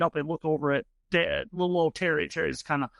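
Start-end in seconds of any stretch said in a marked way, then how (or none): none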